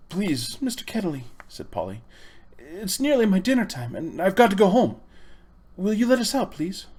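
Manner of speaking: put-on high, anxious girl's plea; pleading girl. A narrator's reading. Polly begs Uncle Andrew to let them go home, high anxious plea. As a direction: beg politely but nervously.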